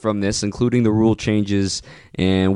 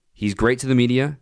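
ordinary delivery

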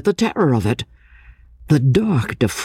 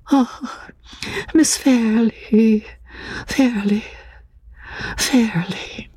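high, slow sigh of a beloved name; loving, calming utterance; 10 semitones higher, much slower than usual. A narrator's reading. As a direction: repeat it tenderly, fading into a whisper. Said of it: "bare utterance of the loved familiar name seemed to quiet her".

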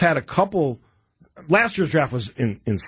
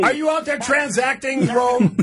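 high shouted question; calling from far away; 10 semitones higher than usual. Real, not acted. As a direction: call out as if across a street. Host shouting question to caller.

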